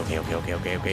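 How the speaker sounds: ok muy bajito y tenso; susurrando. Real, not acted. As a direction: susurrar para no ser oído. El monstruo lo mira. (susurro asustado)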